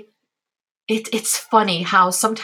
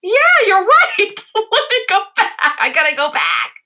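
laughing; 13 semitones higher, 4 dB louder than usual. Retold or imitated. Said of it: "And I'm like".

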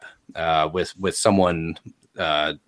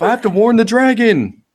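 high, loud fleeing villager; hammed-up high wail; panicked screaming; 8 dB louder than usual. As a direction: yell it while running off in alarm. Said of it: "they all start screaming".

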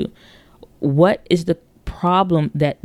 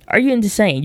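incredulous; 6 semitones higher than usual. Pretend. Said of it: "You're like".

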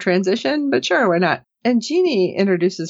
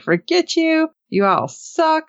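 mock drunken rant; 10 semitones higher, slower than usual. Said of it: "she's mad and she's trashing food".

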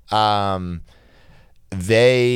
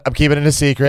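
quoting the coach; 4 semitones higher, 4 dB louder, faster than usual. Retold or imitated. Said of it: "he said".

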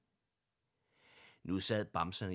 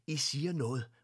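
higher and louder, bursting out; sudden exclamation; 6 semitones higher, 7 dB louder than usual. A narrator's reading. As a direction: blurt it out with a sudden idea. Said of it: "udbrød fru Lindberg pludseligt".

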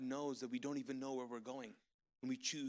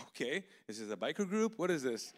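baffled surprise; 2 semitones higher, 5 dB louder than usual. Retold or imitated. Retelling his reaction to a name.

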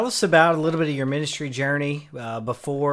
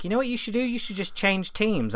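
higher, softly voiced wife; wife's advice; 7 semitones higher, 4 dB quieter than usual. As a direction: lightly imitate a wife offering advice. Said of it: "she's like".

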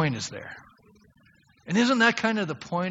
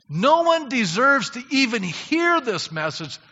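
normal talking voice

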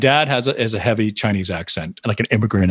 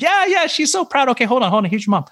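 thrilled dad; 13 semitones higher than usual. Retold or imitated. Dad on the phone confirming mom saw it, high gushing. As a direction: answer like a delighted dad. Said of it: "And she's like".

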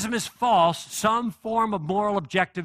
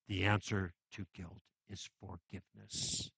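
hushed first delivery; 10 semitones lower, 14 dB quieter than usual. Real, not acted. Repeats the sermon's key line.

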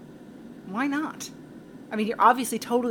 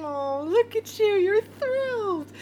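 cooing; 10 semitones higher, 4 dB louder, slower than usual. Acted. Baby-talking to pet Arnold.

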